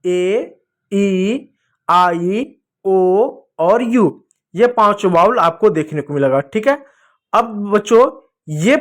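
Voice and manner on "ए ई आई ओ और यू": slow listing. Shown as a demonstration. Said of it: "कौन कौन सा?".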